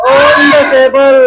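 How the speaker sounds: put-on very slow, each word drawn out for the children; slow model answer. Shown as a demonstration. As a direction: spell it out slowly so kids can repeat. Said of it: "क्या है?". (धीरे-धीरे, हर शब्द खींचकर)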